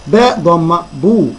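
slow spelling drill, باء ضمة then a long "buu"; teacher demonstrating "buu" slowly after ضمة; letter with damma. Shown as a demonstration. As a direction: sound out بو with a drawn-out oo. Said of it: "الباء هتتنطق ازاي في كل كلمة".

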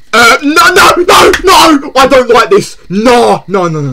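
high, loud, panicked shouting; real panic. Real, not acted. Gamer who doesn't like this, panicked shout. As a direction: shout it out in rising alarm.

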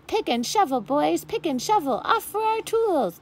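rally the lads to fetch tools; eager dwarfs. A narrator's reading. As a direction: dwarfs rushing off to dig. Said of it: "said the dwarfs".

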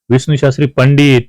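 ordinary delivery

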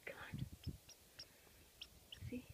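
soft whisper, urging; a whispered come on to the squirrel; whispered coax. Real, not acted. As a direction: breathe it out to lure him.

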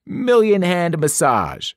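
slow showy sales pitch; salesman pitch. A narrator's reading. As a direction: present the bed like a salesman. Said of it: "Krusty told us".

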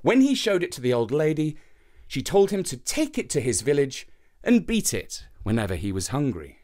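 ordinary delivery, nothing marked

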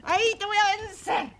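high, excited mock battle; boisterous play-fight voice; playful fighting.

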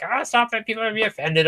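imitating wife. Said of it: "My wife's always just like".

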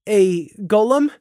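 a touch of acted fear; shaky. A narrator's reading. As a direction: ask it uneasily, rising at the end. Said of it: "Scenic asked shakily".

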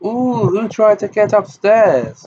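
high, giggly and gleeful. A narrator's reading. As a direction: giggle it out like excited schoolgirls. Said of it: "they giggled happily".

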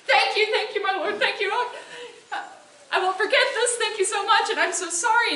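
high, loud, laughing thanks; giddy gratitude. Acted. Woodcutter thanking Jupiter in giddy, laughing relief. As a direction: gush with nervous relief, voice up high.

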